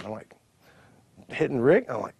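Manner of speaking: usual speaking voice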